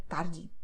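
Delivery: tarde ending in an "ee" sound; final E as I. Shown as a demonstration. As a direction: say the last E of tarde as "ee". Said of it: "will be pronounced as I".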